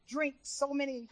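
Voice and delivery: usual speaking voice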